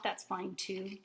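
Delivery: usual speaking voice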